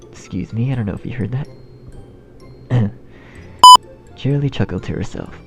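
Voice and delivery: usual speaking voice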